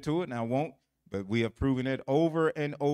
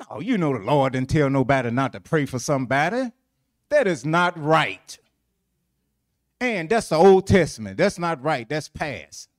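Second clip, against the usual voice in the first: emphatic objection; 8 dB louder than usual. Real, not acted. Preacher objects forcefully.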